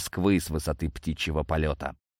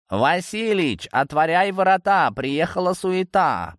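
calling out; 10 semitones higher, 3 dB louder than usual. Acted. Cat summons Василич to open up, calling out high. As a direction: holler playfully up at a window. Cat calls at old man's window.

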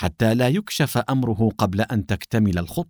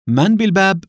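calling out; 9 semitones higher, 5 dB louder than usual. Acted. Calling through the door.

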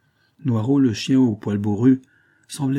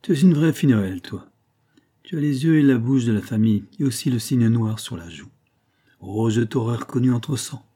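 ordinary delivery

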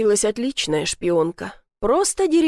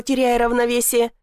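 usual speaking voice

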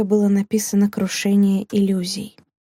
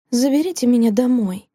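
usual speaking voice

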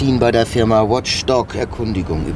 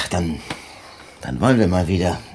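ordinary talk

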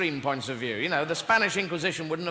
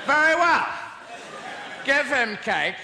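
pompous inquisitor; 7 semitones higher than usual. Retold or imitated. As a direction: announce it with mock grandeur. Inquisitor grants cake.

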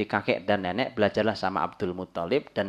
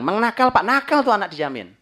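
high, loud mock scolding; scolding parent; 9 semitones higher, 7 dB louder than usual. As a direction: declare the child naughty, hammed-up. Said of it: "Itu anak dibilang".

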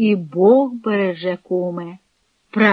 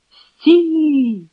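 higher, drawn-out call; shouting halt; 6 semitones higher than usual. A narrator's reading. Kryvonis springs from the shadows at a fugitive, calling out. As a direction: call out to stop a runaway. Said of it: "гукнув Кривоніс".

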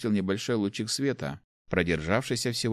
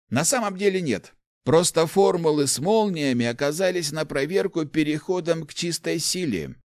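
put-on higher-pitched, animated old man's voice; enthusiastic old inventor; 8 semitones higher, 3 dB louder than usual. Old inventor explains artefacts to the rector, enthusiastic. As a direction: explain your discovery eagerly, pitched up.

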